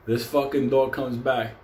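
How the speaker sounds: normal talking voice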